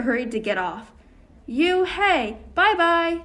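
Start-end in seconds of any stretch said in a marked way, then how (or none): 1.5-3.2 high, loud taunting goodbye; sing-song You, hey, bye-bye shouted; mocking yell. A narrator's reading. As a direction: jeer at her as she leaves. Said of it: "the kids yelled".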